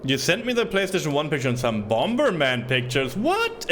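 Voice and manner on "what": high startled what; high surprised yelp. Real, not acted. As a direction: shoot up in surprise. Surprised at picture.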